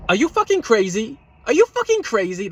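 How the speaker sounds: high, loud disbelieving yell; high-pitched incredulous shout; retold outraged yell. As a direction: yell it back at him, appalled. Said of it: "I said".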